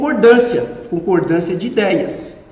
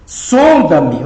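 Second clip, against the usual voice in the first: "Sonda-me" with a heavy, drawn first syllable; "Sonda-me", first syllable strong, then dropping away; stress on SON; 4 semitones lower, 4 dB louder than usual. Shown as a demonstration. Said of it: "No som".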